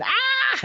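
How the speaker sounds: high gleeful mock shriek; mock laughing shriek. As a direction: shriek with delight like the laughing mother. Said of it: "this mother laughing".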